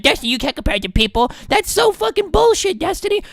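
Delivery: high-pitched whiny imitation of complaining chatters; mock outraged viewer. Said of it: "you are gonna get mad".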